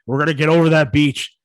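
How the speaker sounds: lightly voiced forties general rallying troops; a hint of a stern wartime commander; mock General Patton. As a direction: forties general rousing the troops before the beach, mock gruff. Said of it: "someone in the forties being like".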